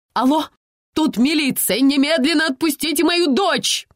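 high, loud phone demand; shouting into the phone. Acted. Mother shouts into the receiver at kidnappers, shouting. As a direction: yell it at the supposed kidnappers. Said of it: "закричала Инна Владимировна в трубку".

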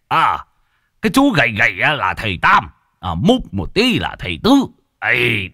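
put-on high, delighted old villager introducing guests; jubilant old man. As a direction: beam with pride, voice pitched high. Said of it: "Ông cụ Bạch cũng hân hoan". (giọng ông cụ hân hoan, cao hẳn lên)

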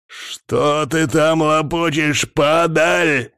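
contemptuous demand, stretched slowly; enraged. A narrator's reading. Enraged hamster threatens the fading girl, snarling. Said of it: "Озверевший саланганец".

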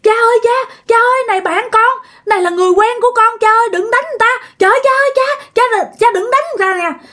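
high, wailing plea, reenacted; retold screaming. Mother begging her dead father to spare friend, retold high wailing cry. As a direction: act out a desperate cry begging father to stop. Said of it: "mẹ la làng lên".

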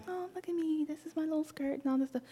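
put-on high, soft, fast girly preening; mock girly show-off. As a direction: coo it like a preening girl.

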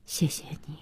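very soft, half-whispered thanks; hushed and understated; low voice. A narrator's reading. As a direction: murmur it quietly at parting. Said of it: "小西低声地说".

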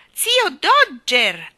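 loud, high, drawn-out warning; sharp admonishment. A narrator's reading. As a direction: cut him off with his name, stretched out. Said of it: "lo ammonì Nelly Rubina". (ammonimento forte e scandito)

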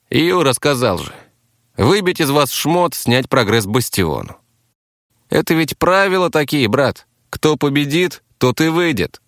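insistent taxi driver. Acted. Captive taxi driver explains the rules to rivals, insistent.

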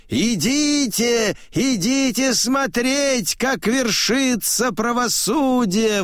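high-pitched summons to a crowd; old man's high calling; crying out. A narrator's reading. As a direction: call the people over in a high, ringing voice. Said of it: "кричит".

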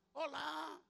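ordinary delivery

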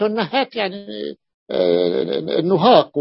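usual speaking voice

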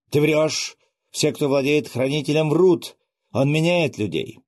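high, loud furious shout; furious accusation. Acted. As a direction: hurl the accusation in rage. Son furiously accuses the emperor. (яростный крик, выше и громче)

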